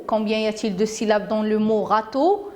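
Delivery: ordinary talk, nothing marked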